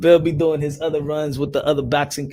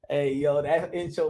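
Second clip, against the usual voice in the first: ordinary delivery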